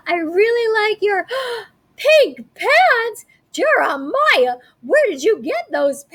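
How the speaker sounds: high, slow, breathless gush with a gasp; gushing teacher. Acted. Teacher admiring the boy's pink pants, high gush.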